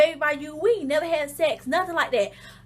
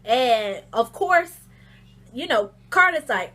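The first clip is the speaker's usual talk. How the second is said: usual speaking voice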